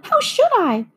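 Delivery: put-on high little-girl voice; protesting Dorothy. A narrator's reading. Dorothy tells the witch she didn't know, high and girlish. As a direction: protest innocence in a high young voice.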